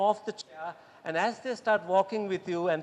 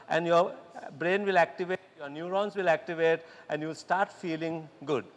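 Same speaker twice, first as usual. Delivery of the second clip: usual speaking voice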